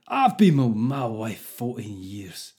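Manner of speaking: slow, badly faked Scottish accent. Podcaster reading Craig's letter to annoy Scottish people, bad Scottish accent. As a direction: put on a deliberately poor, drawn-out Scottish accent. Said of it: "I've got to do this in Scottish accent".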